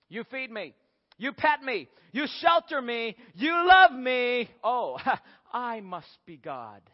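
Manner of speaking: cat working out its own importance, ending with a chuckle; self-satisfied cat. Acted. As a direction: build up, then drop into slow, smug realization. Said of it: "cats say almost the very same thing".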